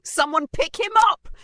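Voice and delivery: loud, high cheering yell; excited shouting. A narrator's reading. Bullies cheer after the hit, shouting excitedly. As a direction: shout it over a rowdy crowd. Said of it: "cheered in excitement, shouting".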